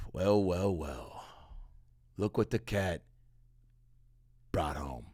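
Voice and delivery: a judgmental cat greeting you coldly at the door; smug cat voice. Pretend. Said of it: "A cat's just like".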